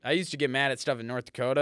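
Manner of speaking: ordinary delivery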